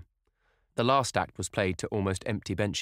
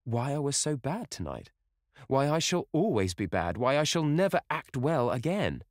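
rising joy; 8 semitones higher than usual. Acted. Sibyl explaining to Dorian, building elation. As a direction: swell with each why.

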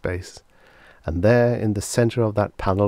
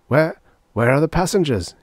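raised, louder, alarmed croak; 8 semitones higher, 5 dB louder than usual. A narrator's reading. Lawyer finding the plane empty, croaking in suspicion. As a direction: croak the question, stumbling on the first word. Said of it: "he croaked".

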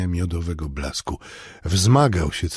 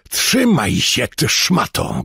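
louder, sharp rebuke; firm shout while gripping him; angry shout; 4 semitones higher, 4 dB louder, slower than usual. A narrator's reading. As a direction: yell at him to hold still. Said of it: "krzyknąłem".

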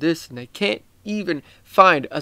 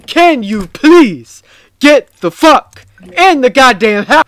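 higher and louder, slow and drawn out, fed up; exasperated yell; 7 semitones higher, 9 dB louder than usual. Real, not acted. Gamer yelling at another player to get inside, slow exasperated yell. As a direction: bellow it slowly at a slowpoke player.

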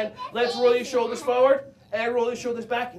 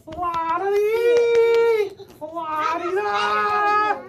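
slow, high, stretched nonsense calls; silly high sing-song; 10 semitones higher, 4 dB louder, much slower than usual. Pretend. Teacher clowning for kids with glued heads. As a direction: draw out silly high sounds. Silly sound while gluing.

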